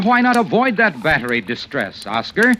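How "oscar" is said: normal talking voice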